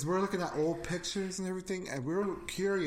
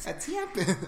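TAMPON in a higher-pitched Wisconsin accent; 5 semitones higher, 3 dB louder, slower than usual. Shown as a demonstration. Repeating it for a baffled friend, Wisconsin accent. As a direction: say TAMPON with a Wisconsin first vowel. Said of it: "this Wisconsin family".

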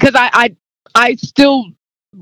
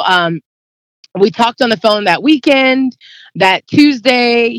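ordinary talk

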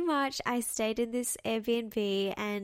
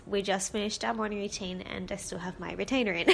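ordinary delivery

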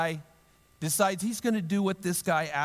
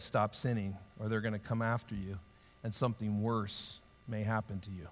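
gentle teasing; 9 semitones lower, 7 dB quieter than usual. Retold or imitated. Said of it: "Jesus is poking fun".

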